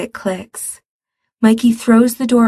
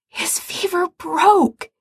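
high, slow, breathless relief; 9 semitones higher, slower than usual. Acted. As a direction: gasp it out with relief. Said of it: "Ray says breathlessly".

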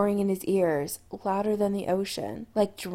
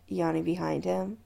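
usual speaking voice

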